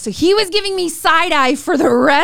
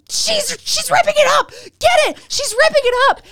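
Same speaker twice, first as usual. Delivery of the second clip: much higher imitated frantic teacher; 14 semitones higher than usual. Teacher telling the principal she's destroying the note, mock frantic. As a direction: squeal it like he's losing the evidence.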